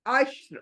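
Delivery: अश्र with a thick, full र; heavy ra. Shown as a demonstration. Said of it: "रॉ को मोटा ही करके पढ़ेंगे". (मोटी र के साथ अश्र)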